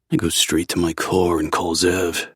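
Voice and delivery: plain reading voice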